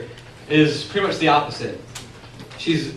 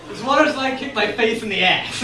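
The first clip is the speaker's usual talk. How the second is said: normal talking voice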